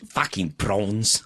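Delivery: slow South African accent. Shown as a demonstration. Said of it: "you can say it in the South African accent".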